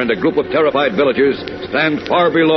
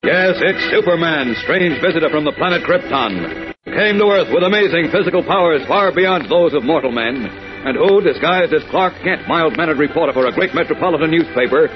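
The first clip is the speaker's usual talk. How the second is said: put-on grand old radio narrator; announcer; 5 semitones higher, 4 dB louder than usual. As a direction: build it up like a serial intro. Narrator's grand Superman intro.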